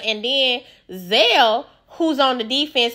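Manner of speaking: ordinary talk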